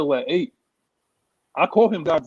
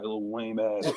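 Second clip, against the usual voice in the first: muttered insult; 8 semitones lower, 8 dB quieter than usual. Real, not acted. Dismissive aside about Russell Gage.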